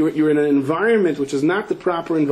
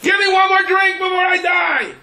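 much higher, loud mock shout; panicked yell; 5 dB louder than usual. Preacher imitating a plane passenger's last request, loud mock shout. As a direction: yell it like a passenger sure he'll crash. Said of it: "He yells".

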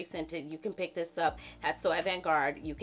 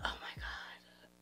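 a hushed, voiceless gasp of realization; imitated whisper; 15 dB quieter, much slower than usual. A viewer voiced noticing the wrong bottle, whispered. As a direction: breathe it like a caught mistake. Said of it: "Somebody might be saying".

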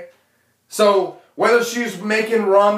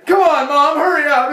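put-on high, loud pleading child; mock panicked kid; 10 semitones higher, 5 dB louder than usual. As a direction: beg mom to finish, high and loud. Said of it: "kid being whipped".

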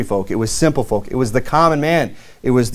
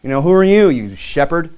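mild mock anger; 6 semitones higher than usual. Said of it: "His brother gets all mad and says".

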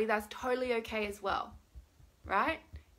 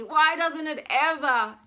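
loud, high, drawn-out whine; exaggerated whining protest; really whiny; 5 semitones higher, 9 dB louder, slower than usual. Shown as a demonstration. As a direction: whine harder, stretching the words. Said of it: "And get really whiny".

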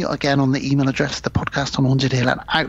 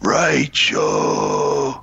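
slow drawn-out zombie groan. Pretend. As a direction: moan the names like the undead. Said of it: "in my best zombie voice".

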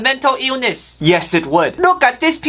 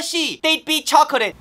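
ordinary talk, nothing marked

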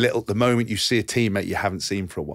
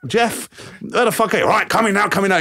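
much higher, pushy phone call; mock urgent call; 9 semitones higher than usual. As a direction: hurry an old man up. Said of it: "prank call to Jeff".

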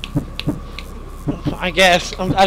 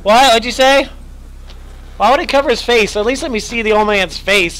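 ordinary talk

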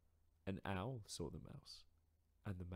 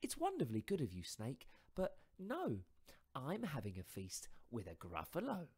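higher, louder mouse voice, spinning a tall tale; boastful little mouse; 6 semitones higher, 4 dB louder than usual. Acted. Mouse inventing a monster to frighten fox, higher and louder.

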